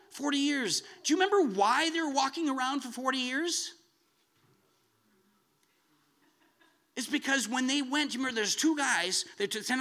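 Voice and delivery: high, loud preacher's fervour; preaching at full force. Acted. Preacher to congregation, booming and fervent. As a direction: rise and thunder the promise to the congregation.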